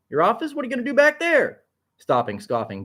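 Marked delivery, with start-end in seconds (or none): 0.1-1.6 much higher, bossy mocking voice; mocking secretary. A narrator's reading. Bertha mocks Onyx heading to his office, high and needling. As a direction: needle him about working.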